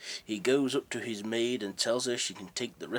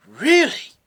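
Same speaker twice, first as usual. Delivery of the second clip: much higher and much louder astonished cry; surprised; 19 dB louder than usual. Acted. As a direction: gasp it in disbelief. Gentleman surprised by donation.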